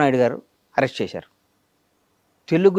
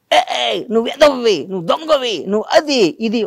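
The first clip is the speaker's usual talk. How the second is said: high jeering voice, mimicking a bully; mocking taunt; 9 semitones higher, 3 dB louder than usual. Mimicking bullies who jeer at a fallen leader, high mocking taunt. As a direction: sneer down at a man who lost power.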